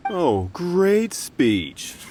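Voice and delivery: sarcastic praise. Acted. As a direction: sneer at the speech, very slowly. Thug mocks the speech.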